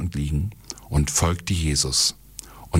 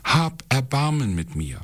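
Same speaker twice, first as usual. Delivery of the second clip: crying out; 8 semitones higher than usual. A narrator's reading. Blind Bartimäus begs Jesus for mercy, crying out. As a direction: plead in a raised pitch. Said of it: "schrie Bartimäus".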